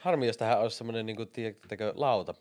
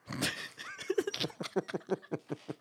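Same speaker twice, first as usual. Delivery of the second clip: high, breath-heavy laugh; long wheezy giggle with little voice; laughing at joke; 10 semitones higher, 4 dB quieter than usual. Real, not acted. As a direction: laugh helplessly, high and airy.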